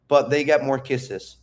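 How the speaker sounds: ordinary talk, nothing marked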